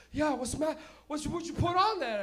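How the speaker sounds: high, loud mock panic; alarmed father. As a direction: demand it in rising comic alarm. Dad alarmed by the burning sauce.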